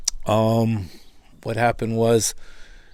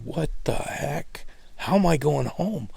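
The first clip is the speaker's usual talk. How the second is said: bewildered thought; 3 semitones higher, 11 dB quieter, slower than usual. Retold or imitated. Retold inner thought.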